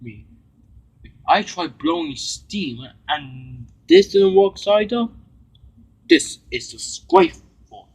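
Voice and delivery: high, loud, indignant complaint; a cross engine protesting loudly to himself; cross Spencer. Acted. A cross engine who feels left out, high and loud. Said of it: "Spencer was so cross".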